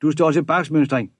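stern order to a cadet on parade; roared rebuke. A narrator's reading. Chief mate reprimands cadet at muster, loud rebuke. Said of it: "brølede overstyrmanden".

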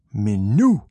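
sudden loud cry, leaping up in pitch; excited scream. A narrator's reading. Leo forgets he is an ordinary boy, screaming. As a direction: yell it out like a boy's battle cry. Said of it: "skreg Leo".

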